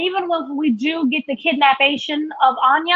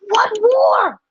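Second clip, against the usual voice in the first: higher indignant "What war?"; 7 semitones higher, 4 dB louder than usual. Real, not acted. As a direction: toss the question back in disbelief. Indignant repeated question.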